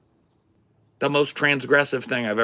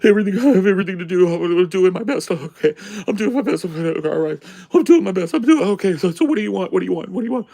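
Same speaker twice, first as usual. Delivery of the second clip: frantic high-pitched mock wail, rushed; whining and crying; 10 semitones higher, 5 dB louder, faster than usual. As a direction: imitate a tearful waitress falling apart, loud and high. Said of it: "she's whining and crying to every customer".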